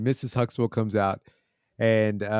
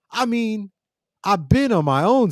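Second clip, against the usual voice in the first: put-on high-pitched teen protest; Theo, protesting; 10 semitones higher, 3 dB louder than usual. Narrator imitating Theo challenged on age, high-pitched. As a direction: imitate a boy starting his excuse in a high voice.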